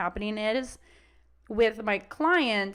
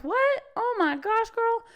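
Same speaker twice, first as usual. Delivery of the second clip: much higher, gushing dog voice; thrilled dog; 11 semitones higher than usual. Retold or imitated. Dog seeing hot dogs, much higher and gushing. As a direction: squeal like a dog that spotted treats.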